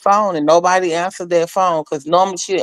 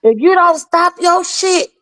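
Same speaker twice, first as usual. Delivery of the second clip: much higher, slow warning; threatening scold; 11 semitones higher than usual. Retold or imitated. Mama D warning Dana to stop, slow threat. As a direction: lay down the law slowly.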